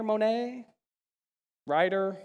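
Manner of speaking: usual speaking voice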